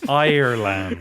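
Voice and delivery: loud "Ireland" split as "Ire-land"; exaggerated pronunciation. Echoing the coworker's realisation, exaggerated "Eye-er-land". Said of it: "The land of Ire".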